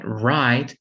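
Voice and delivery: "Ride" with a long I, like "eye"; long vowel. Shown as a demonstration. Teacher demonstrating the rule to learners, long I in "Ride".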